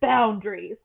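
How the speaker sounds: hammed-up stormy personality; mock stormy voice. Shown as a demonstration. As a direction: overdo the gravitas, as if making fun. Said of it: "sounds like I'm making fun of them".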